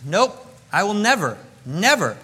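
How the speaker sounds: loud, emphatic never; Peter's stubborn denial; defiant Peter. Retold or imitated. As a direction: insist loudly you never will. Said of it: "He said".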